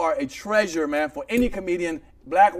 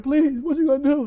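high, loud pleading; scared golfer; 11 semitones higher, 6 dB louder than usual. Retold or imitated. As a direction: beg in mock fright. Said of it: "The golfer was scared".

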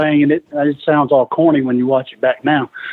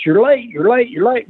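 retold yelling; 7 semitones higher than usual. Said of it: "everybody's yelling at us".

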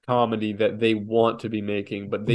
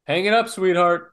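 mock dismissive; 7 semitones higher, 3 dB louder than usual. Mocking dismissal of the comedian.

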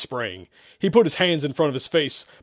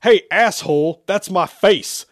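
loud, higher-pitched indignant yell; angry shout; 7 semitones higher, 8 dB louder, slower than usual. A narrator's reading. Zach offended at insult to his face, angry shout. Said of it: "Zach shouted angrily".

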